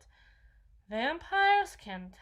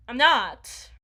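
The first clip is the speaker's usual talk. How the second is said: defensive burst; 13 dB louder than usual. Acted. Defensive outburst about virginity.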